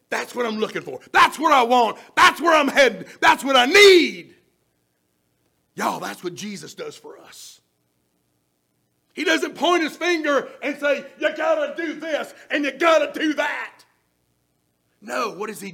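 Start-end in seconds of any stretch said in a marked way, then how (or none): 0.1-4.4 loud, rising, each line pushed harder; preaching climax. Acted. Customer's answer turned into a shouted sermon climax. 11.2-13.8 finger-pointing command. Acted.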